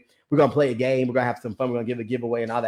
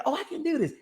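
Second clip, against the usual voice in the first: encouraged self-talk; 14 semitones higher, 5 dB quieter, faster than usual. Retold or imitated. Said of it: "you start saying".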